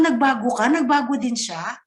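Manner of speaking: ordinary delivery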